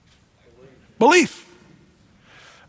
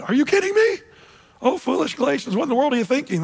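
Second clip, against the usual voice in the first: incredulous, much higher pitch, pleading with foolish friends; exasperated Paul; 6 semitones higher, faster than usual. Retold or imitated. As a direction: throw up your hands at the Galatians in disbelief. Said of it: "sense the exasperation here of Paul going".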